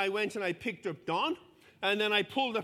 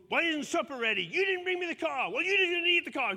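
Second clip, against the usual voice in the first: rising to a much higher, louder mock shout; acted quarrel; 8 semitones higher, 4 dB louder than usual. Mentor acting out the couple's fight over the car, building to a mock shout. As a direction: act out the spat, building to a yell.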